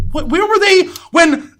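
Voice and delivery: high, loud and slow disbelief; a mock outraged question, high and loud; incredulous outburst. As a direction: demand it, outraged and baffled. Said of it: "and I'm like".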